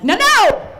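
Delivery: high loud "No, no!"; shout. Retold or imitated. Stopping her crying friend, a high yell. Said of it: "I'm like".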